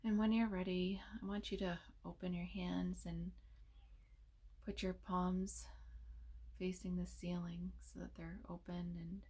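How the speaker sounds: soft, unhurried meditation voice with long pauses; hushed, soothing delivery, leaving space between phrases; guided meditation. Real, not acted.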